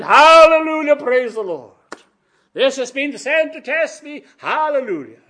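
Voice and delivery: high, loud pretend hallelujahs; hammed-up rejoicing in a trial; mock jubilant praise. Preacher voices what the frightened disciples weren't saying, mock jubilant praise.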